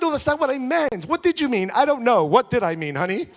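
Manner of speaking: loud, high, pleading excuses to the wife; husband flustered in a quarrel with his wife, loud and high; heated quarrel. Retold or imitated. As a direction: raise your voice and scramble to explain.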